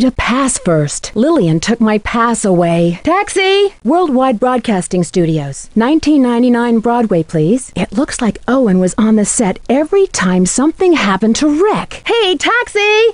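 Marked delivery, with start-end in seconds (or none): 3.0-3.7 high carrying call to a cab; calling a taxi. Acted. Nancy hailing a cab from the curb, high call. 12.1-13.1 high, drawn-out call for a cab; calling a taxi. Acted. Nancy flagging down a passing taxi, long high call.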